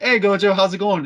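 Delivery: high, loud, chummy hello; cheery high greeting. Retold or imitated. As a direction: greet him like an old pal. Fake friend greeting Gojo.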